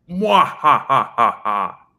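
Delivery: high cackling villain laugh; mwah-ha-ha like a cartoon villain; evil laugh. Shown as a demonstration. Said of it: "give an evil laugh, like".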